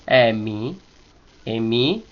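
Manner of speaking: "Emi" starting low and rising on "mi"; drawn-out "Emi" climbing from a low E; low initial accent. Shown as a demonstration. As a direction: begin low on the E, lift the end of "Emi". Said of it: "del principio es con acento bajo".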